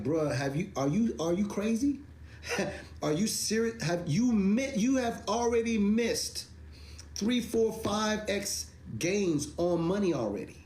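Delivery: high, incredulous mock rant at the doubters; exasperated retort. Mocking the Bitcoin arguers, high and incredulous. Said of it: "I'm like".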